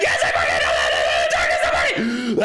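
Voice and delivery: loud, low angry yelling; furious outburst. Retold or imitated. Worker finally snapping at her manager over hours, furious outburst. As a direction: let the anger explode at the manager. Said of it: "That was an interesting outburst".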